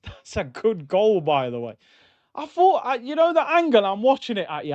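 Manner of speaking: ordinary delivery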